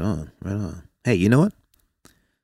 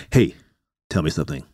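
ordinary talk

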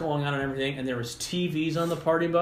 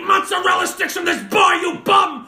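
high, full-volume ranting bellow; Bar Rescue host; 9 dB louder than usual. Acted. Bar expert tearing into a bar owner, shouted impression. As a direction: berate them at the top of your voice.

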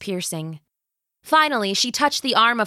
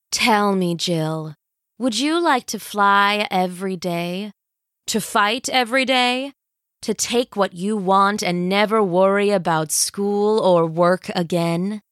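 scheming villain queen. Acted. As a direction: savor every word like a tyrant. Villain tempts Jill.

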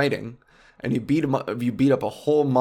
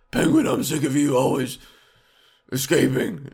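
put-on superhero voice; mock vigilante fed up with a villain; Batman; 3 dB louder than usual. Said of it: "Batman has the nerve to be like".

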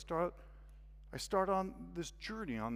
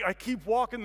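ordinary delivery

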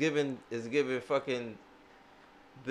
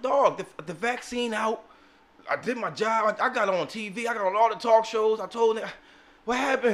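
higher, louder, pleading Fauci; pleading Fauci impression; 6 semitones higher, 4 dB louder than usual. Podcaster voices Fauci pleading with partners, higher and louder. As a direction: play Fauci begging for his share.

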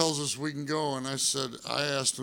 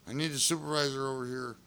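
ordinary talk, nothing marked

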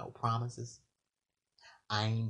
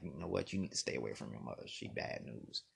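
lower, softer, fast hushed aside; secret warning; 8 semitones lower, 10 dB quieter than usual. Retold or imitated. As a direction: drop to a conspiratorial whisper. Secret warning about girlfriend's mother.

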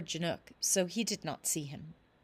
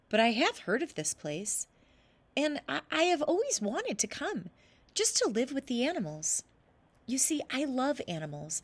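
put-on higher, eager boy's voice; trickster tale voices; 6 semitones higher than usual. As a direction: plead brightly like a young boy. Boy pleads with spider.

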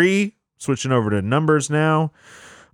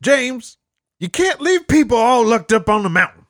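high, louder mock scolding; 12 semitones higher, 4 dB louder than usual. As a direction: scold him in a shrill high voice. Scolding James in role.

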